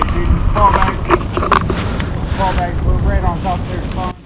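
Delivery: shouted order to fall back; loud callout. Real, not acted. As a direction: yell it across the field. Calling team to retreat.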